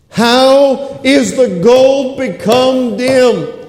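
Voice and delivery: high, slow lament; Jeremiah crying out. Retold or imitated. Preacher voicing Jeremiah's lament over the burnt city, high slow cry. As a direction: cry it out like a grieving prophet. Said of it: "He's crying out and he's saying".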